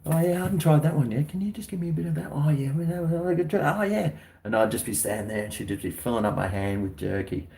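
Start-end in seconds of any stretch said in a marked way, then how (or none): 0.1-4.1 high quick mock customer; eager taster. Himself at the stand begging for jerky, high and quick. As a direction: rattle it off as a greedy sampler, high and fast. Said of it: "just like".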